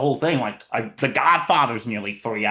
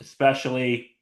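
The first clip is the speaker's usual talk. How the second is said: normal talking voice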